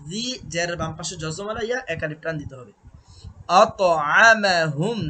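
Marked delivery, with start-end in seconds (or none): 3.6-5.0 আত with a bouncing echo on the ত; slow আতআমাহুম with a clear qalqalah on ত. Shown as a demonstration. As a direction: let the ত in আত bounce with a small echo. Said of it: "আত এটাকে কলকলা বলা হয়".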